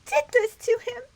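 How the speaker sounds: high, rising to a loud theatrical cry; melodramatic heroine. Acted. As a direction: plead like a stage heroine. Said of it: "Nineteenth century acting".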